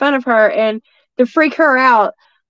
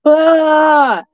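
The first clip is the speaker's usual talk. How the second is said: loud, high drawn-out "aah"; mocking Bigfoot scream; 4 semitones higher, 6 dB louder than usual. Shown as a demonstration. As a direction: imitate a creature's jeering holler. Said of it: "it comes across my bridge like this".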